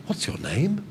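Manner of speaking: "What's your name?" with the pitch climbing sharply to the end; rising intonation. Shown as a demonstration. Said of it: "with a rising intonation".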